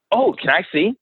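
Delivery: put-on high, eager little girl asking; high-pitched eager kid; eager daughter voice.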